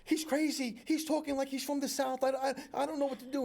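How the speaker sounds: wife complaining her husband talks Southern, mock frantic. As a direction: play the wife, worried and rushed. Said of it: "She's like".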